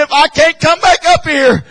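high, loud cry that slows to a plea at the end; pleading shout. Acted. Preacher shouting an old minister's plea to the young folks. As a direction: cry out the old warrior's last request.

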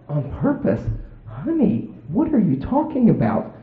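high-pitched feigned innocence; put-on puzzled, high husband voice; playing clueless. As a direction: act as if you have no idea. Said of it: "acted like I had no idea".

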